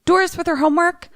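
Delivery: high, gentle priest voice; lightly voiced priest. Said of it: "So he says".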